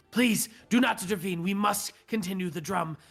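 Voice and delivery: high, pleading goblin voice; reedy little goblin drummer pleading; goblin ritualist. Acted. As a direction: beg them not to interfere, high and urgent.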